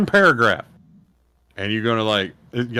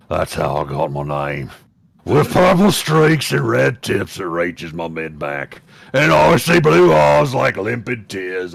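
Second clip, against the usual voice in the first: orc voice; 5 semitones lower than usual. Acted. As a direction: read aloud as an orc, lurching from low drone to bellow. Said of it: "I'll do it in the orc voice".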